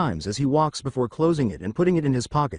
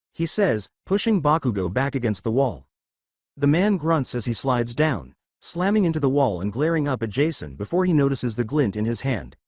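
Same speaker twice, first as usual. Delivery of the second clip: usual speaking voice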